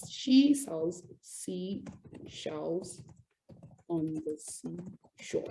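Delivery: very slow, word by word; slow tongue-twister demo. Shown as a demonstration. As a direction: draw out each word carefully, softly. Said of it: "say this five times really fast".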